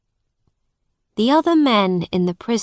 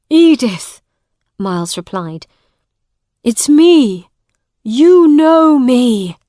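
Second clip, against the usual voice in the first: much higher, louder pleading; desperate plea; 5 semitones higher, 10 dB louder than usual. A narrator's reading. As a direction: beg her to remember. Miles pleads with Edith.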